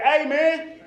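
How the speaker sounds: loud, high-pitched protest; loud complaint. Acted. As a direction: complain loudly, pitched right up. Complaining to doctor on phone.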